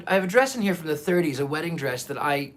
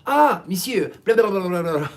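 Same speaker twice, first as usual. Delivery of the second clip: mock native French; 3 semitones higher, 4 dB louder than usual. French speaker answering the host, mock French chatter. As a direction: imitate a stream of gabbled French. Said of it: "people who speak French naturally will go".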